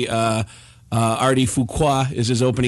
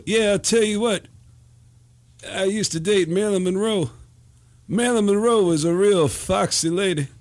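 higher put-on crooner voice, halting phrases; 6 semitones higher than usual. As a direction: imitate an old crooner reminiscing between pauses. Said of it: "[name] does this [name] impression".

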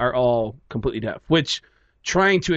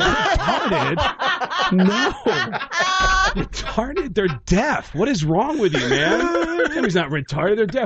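raised, high-pitched outrage with laughter around it; indignant disbelief; 9 semitones higher than usual. Retold or imitated. Comic correcting a clueless comedian, high indignant retort. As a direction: throw the word back in appalled disbelief.

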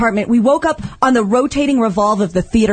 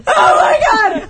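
much higher, cracking, half-laughing cries of panic; panicked screaming; 12 semitones higher, slower than usual. Retold or imitated. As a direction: relive the terror, rising to a shriek on who are you. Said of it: "And I kept screaming that".

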